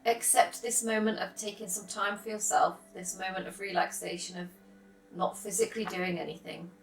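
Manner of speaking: calm, unhurried meditation voice with long pauses; soft, settled yoga nidra guidance; guided meditation. Real, not acted.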